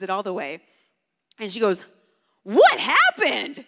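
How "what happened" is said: loud, high, drawn-out mock shriek; big stretched-out cry of astonishment, acted; child's shocked outburst. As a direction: imitate a little kid exclaiming at full volume. Retells daughter's shocked discovery.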